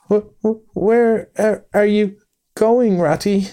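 high, stammering alarmed Mole; a frightened little mole calling after his friend; tearful cry. A narrator's reading. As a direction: stutter it out, close to tears. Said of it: "cried a tearful Mole".

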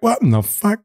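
high-pitched, retold astonishment; mock disbelief. Smuggler recalling cows tumbling off a boat, incredulous. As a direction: act out a baffled double take.

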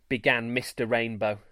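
usual speaking voice

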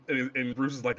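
ordinary talk

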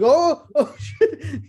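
high, laughing "oh shit". Retold or imitated. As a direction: laugh out the shock.

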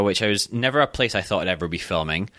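ordinary talk

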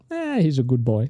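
dismissive mother impression. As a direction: brush it off like an unimpressed mum. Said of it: "she'll just go".

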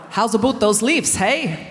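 put-on fuller Canadian accent with "about" rounded. Comedian retries the elders' line, stronger Canadian accent. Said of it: "I haven't said that right".

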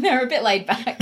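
real laughter in the voice; amused laughing. Real, not acted. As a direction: laugh as you say it. Laughing about the quiet cinema crowd.

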